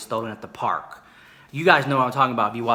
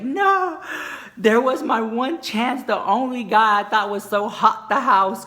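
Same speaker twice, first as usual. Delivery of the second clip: mock wail; 9 semitones higher, 4 dB louder, slower than usual. Friend mourning lost chance.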